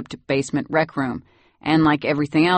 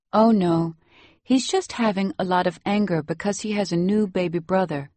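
lightly voiced Salvadoran nanny; Salvadoran accent; 3 semitones higher than usual. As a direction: tell it in Salvadoran-accented English. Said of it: "You have such a nice accent".

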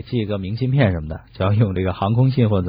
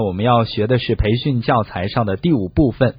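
usual speaking voice

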